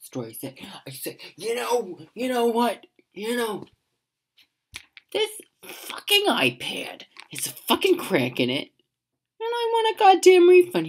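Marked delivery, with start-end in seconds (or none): 1.4-8.7 mock complaint. Complaining at store counter. 9.4-10.9 loud, high angry demand for a refund; furious customer shouting at the clerk. Retold or imitated. Customer yelling at the store clerk, furious and loud.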